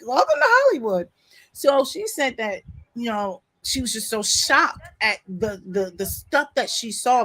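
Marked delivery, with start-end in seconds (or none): 0.1-1.1 high, loud sardonic welcome laughing through it; laughing, mock-grand welcome. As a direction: toss it off like a jaded insider. Mocking her naive friend.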